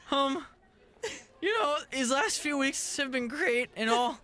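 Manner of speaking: put-on nervous boy dumping his girlfriend; middle school voice. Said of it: "That's my middle school voice".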